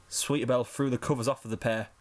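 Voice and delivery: ordinary delivery, nothing marked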